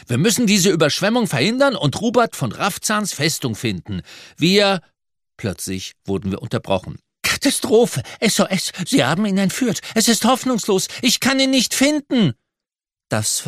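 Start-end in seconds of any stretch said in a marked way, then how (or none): 0.1-4.8 high, urgent rallying cry; rally the others in a raised pitch; calling out. A narrator's reading. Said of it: "rief ich". 7.2-12.3 very high, panicked wail; distraught. A narrator's reading. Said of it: "Seine Stimme klang schlimm".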